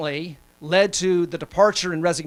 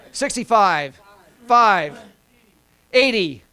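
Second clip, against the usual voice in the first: louder auction calls, one number each; auctioneer; 2 semitones higher, 4 dB louder, slower than usual. Pretend. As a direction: call the bids like an auctioneer. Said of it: "I feel like an auctioneer".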